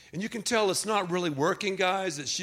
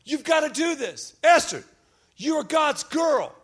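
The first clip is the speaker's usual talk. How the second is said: Mordecai urging Esther; 7 semitones higher, 4 dB louder than usual. Retold or imitated. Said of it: "So Mordecai said".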